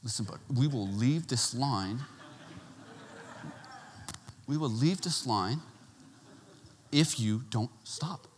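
low, quiet, deliberate warning; imitated stern dad with measured pauses; firm father. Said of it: "he tried the firm father hand".

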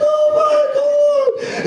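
very high, drawn-out squeal; acted overjoyed cry. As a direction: gush it slowly in a hammed-up falsetto. Overjoyed at seeing old parishioners.

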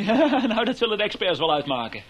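laughing it off loudly, mocking; scornful laugh. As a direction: laugh in his face while talking. Mocking laugh during accusation.

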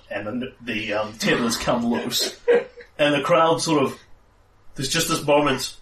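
ordinary delivery

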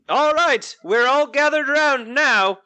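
high, slow off-character voice; wrong character voice. Acted. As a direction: try the innkeeper, miss the voice. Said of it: "That's not his voice".

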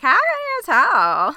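high, loud, drawn-out mock disbelief; mock astonishment. Travellers answering New Yorkers who spotted them, mock astonishment. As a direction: retell it as a big, slow exclamation. Acting out surprised reply in New York.

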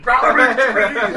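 drawn-out roaring war cry; orc battle yell. Retold or imitated. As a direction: bellow it like a charging orc. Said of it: "a certain percentage of the population is just yelling".

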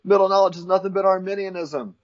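high mock rebuttal; objecting Calvinist. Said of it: "And then the Calvinist will say".

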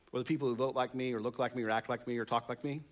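ordinary delivery

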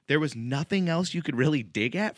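normal talking voice

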